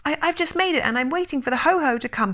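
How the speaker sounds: high, hurried, stammering explanation; nervous little animal, speeding up; flustered and high. A narrator's reading. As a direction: gabble an excuse in a squeaky panic. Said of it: "said Piglet huskily".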